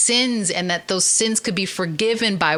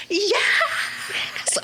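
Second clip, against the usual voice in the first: high shouted Yes with a laugh; a whole church yelling yes; giddy crowd cheer; mock cheer.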